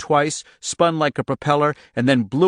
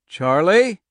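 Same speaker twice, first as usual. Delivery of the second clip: calling out; 8 semitones higher, 7 dB louder than usual. Acted. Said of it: "he called".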